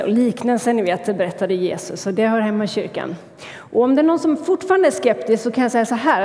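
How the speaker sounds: usual speaking voice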